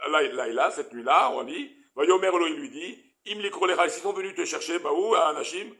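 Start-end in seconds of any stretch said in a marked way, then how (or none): none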